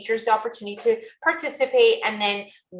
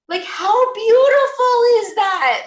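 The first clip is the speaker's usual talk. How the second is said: 1 delighted; 12 semitones higher, 7 dB louder, slower than usual. Real, not acted. Thrilled by shared designs.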